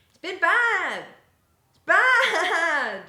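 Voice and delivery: loud, high wail on "bad"; emphatic complaint. Real, not acted. Streamer condemning every bought blueberry muffin, loud emphatic complaint. Exaggerated verdict on shop muffins.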